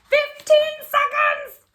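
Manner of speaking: high, loud, slow shout of joy; thrilled yell of the result; triumphant shout. Acted. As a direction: burst out with the winning time. Shouting the winning time.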